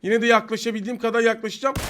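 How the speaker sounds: normal talking voice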